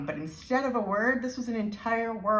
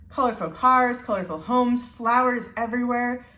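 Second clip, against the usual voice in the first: excited listing; 5 semitones higher, 5 dB louder than usual. Acted. Woman describing island color.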